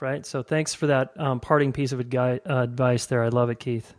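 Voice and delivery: usual speaking voice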